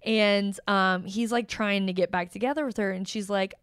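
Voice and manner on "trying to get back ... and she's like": ordinary delivery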